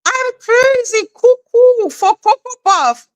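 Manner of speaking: high, loud sing-song cuckoo voice; hammed-up cartoon loony; bouncing like a cereal ad mascot. Cereal jingle parody.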